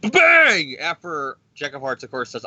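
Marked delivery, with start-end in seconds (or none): 0.1-0.8 mock explosion. Explosion in recap.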